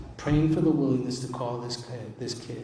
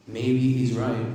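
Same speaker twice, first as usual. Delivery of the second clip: slow, doubtful musing; 4 dB louder, slower than usual. Retold or imitated. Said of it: "That's what I said".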